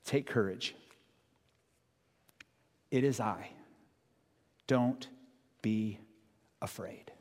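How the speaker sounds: soft, slow, fading to a whisper; hushed words of Jesus; tender reassurance. Acted. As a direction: gently comfort the frightened. Listen to the words that Jesus says.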